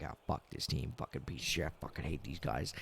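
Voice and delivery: soft bitter mutter at the TV; muttered curses. Retold or imitated. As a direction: grumble the curses to yourself, barely voiced. Muttering curses during losing game.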